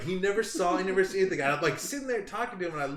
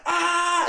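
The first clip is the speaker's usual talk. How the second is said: loud high-pitched scream; acted scream; 12 semitones higher, 6 dB louder than usual. Prankster still pretending the bobcat has him, acted scream. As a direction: keep up the fake panicked yell. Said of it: "I'm sitting there going".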